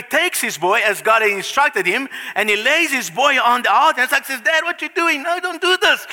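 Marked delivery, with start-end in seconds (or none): 4.4-6.1 put-on high pleading child's voice; high, alarmed plea of a boy; frightened boy. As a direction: cry out like a scared son.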